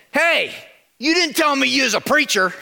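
a rough cook calling him out, annoyed; irritated. Acted. Diner owner confronting Pastor Tony, irritated and loud. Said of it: "a little bit irritated".

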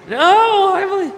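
put-on high, squeaky child's answer; kid voice. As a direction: pipe up like an eager little kid. Mocking eager Sunday-school child.

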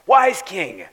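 the corrected, reverent way to address the king; grand address. Retold or imitated. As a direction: hail him properly, with ceremony. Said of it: "They didn't say it that".

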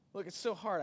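ordinary talk, nothing marked

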